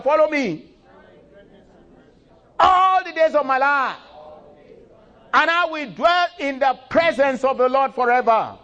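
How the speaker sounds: very high, loud, soaring declamation; climactic preaching. Acted. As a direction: lift the psalm to its peak. Preaching the psalm aloud.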